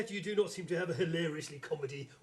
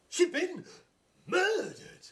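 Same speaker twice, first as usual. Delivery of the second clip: higher, louder declaiming of murdered; mock dramatic; 9 semitones higher, 4 dB louder, slower than usual. Inspector restates the death with ham drama. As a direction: announce it like a cliffhanger.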